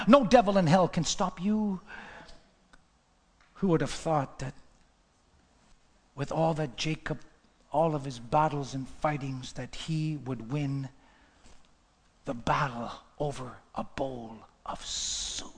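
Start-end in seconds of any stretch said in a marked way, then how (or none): 3.5-15.5 low, soft, slow with weighty pauses; hushed, solemn preaching. Real, not acted. Preacher to friends after years of struggle, low and solemn. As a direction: speak tenderly into the quiet.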